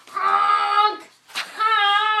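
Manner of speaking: loud, high, drawn-out honks; blaring bird-call imitation; swan honk. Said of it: "Swan goes".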